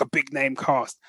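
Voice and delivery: normal talking voice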